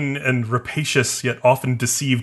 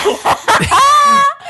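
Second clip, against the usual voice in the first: shrieking burst of laughter; a high, loud laughing shriek; 10 dB louder than usual. Real, not acted. Laughing at absurd plot.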